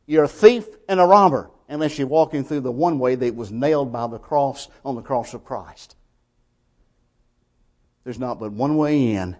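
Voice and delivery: ordinary talk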